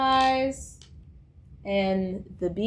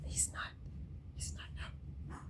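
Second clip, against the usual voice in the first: unvoiced secret whisper to the camera; whispered aside; 23 dB quieter, slower than usual. Real, not acted. Confiding to her viewers that he is not her friend, whispered. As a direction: lean in and whisper the truth.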